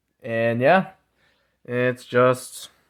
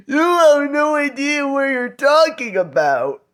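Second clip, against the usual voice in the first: much higher whiny mock voice; mocking critics; 12 semitones higher than usual. Mocking critics who say he is clueless, in a high whiny voice. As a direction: sneer it back like an offended crowd. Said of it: "they're gonna be like".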